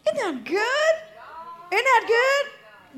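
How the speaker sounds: high, loud, delighted; excited call-out. Real, not acted. Preacher calling to the church, high excited call. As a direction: sing it out to the crowd, second one slow.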